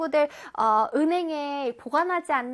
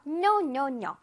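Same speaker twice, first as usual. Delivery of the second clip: normal talking voice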